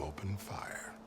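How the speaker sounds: soft, breathy threat; quiet cold menace; menacing whisper. Acted. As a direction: let the threat drop to a whisper. Threatening a captive with soldiers.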